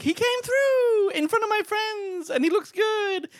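slow, very high falsetto gush; mock swooning woman. Woman whose man showed up, mock swooning falsetto. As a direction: imitate a thrilled woman in drawn-out high falsetto. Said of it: "that's a moment for women where it's like".

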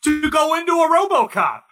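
loud, high-pitched ranting; loud sharp voice. Real, not acted. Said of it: "Why are you yelling at".

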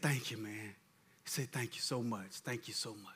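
choked, quiet tearful thanks; crying thanks. Retold or imitated. A grateful man thanking the preacher, softly tearful. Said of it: "boo-hoo crying".

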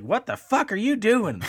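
high, loud imitated outburst; mock outrage. Co-host calling out the fake host voice, mock outrage. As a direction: burst out like an appalled co-host. Retells co-host's outraged interruption.

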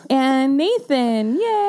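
drawn-out Nathan then a high yay; excited cheer. Real, not acted. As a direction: cheer the name with delight. Cheering guest introduction.

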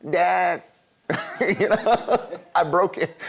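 laughing mock child voice; sheepish child. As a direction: play the guilty little kid owning up. Child confessing to father.

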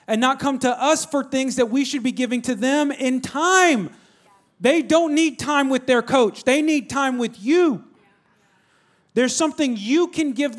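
high, loud preacher's push, slowing at the end; preaching at full force. Real, not acted. Preacher urging parents to give their kids time, full force. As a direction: drive the point home to the congregation.

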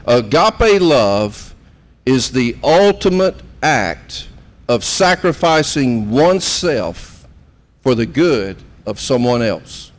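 slow, each word weighed; slow deliberate reading. Shown as a demonstration. Pastor defining agape love for the congregation, slow and deliberate. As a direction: lay out the definition word by word, slowly. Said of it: "this is so significant".

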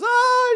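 high shrill shriek; a high dramatic shriek. Retold or imitated. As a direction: let out a sudden high scream. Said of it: "and says".

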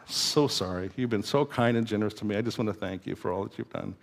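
low, hushed apology; soft apology. Retold or imitated. Coaches a teen's apology.

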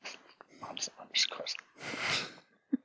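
slow whisper to himself. Real, not acted. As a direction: mutter it under your breath while typing. Muttering while typing a handle.

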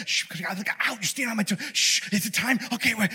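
soft hushed mock whisper; hiding behind the cloud, shushing; hushed hiding angels. Angels hiding at a surprise party, hushed. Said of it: "They're like".

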